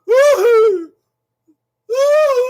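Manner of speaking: loud, very high falling whoop. Real, not acted. Performer breaking off the chant, whooping high and loud. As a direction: let out two piercing whoops.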